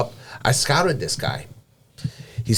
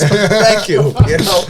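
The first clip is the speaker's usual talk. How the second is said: laughing; 9 semitones higher, 9 dB louder, slower than usual. Real, not acted. Laughs at own punchline.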